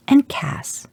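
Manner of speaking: put-on high, bright little girl chiming in; piping child voice. A narrator's reading. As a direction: pipe up eagerly like a young daughter. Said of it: "your daughter piped in".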